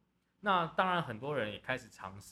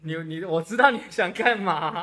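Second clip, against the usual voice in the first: playful teasing; 6 semitones higher, 13 dB louder than usual. Real, not acted. Teasing co-host, loud.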